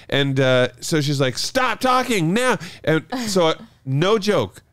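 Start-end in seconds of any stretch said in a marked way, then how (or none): 1.4-2.6 high, slow mock demand; imitated angry order. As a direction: imitate a fed-up neighbour, stretching each word. Retelling the angry neighbour's order.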